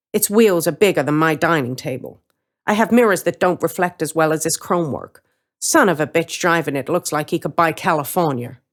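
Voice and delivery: plain reading voice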